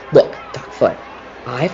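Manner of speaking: a quick whispered appeal; hushed plea. Acted. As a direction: lean in and plead under the breath. Faye pleads before bribing him.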